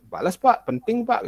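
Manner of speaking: high, pushy pleading; impatient kid begging for a reply; mock demanding student. Spamming teacher demanding reply.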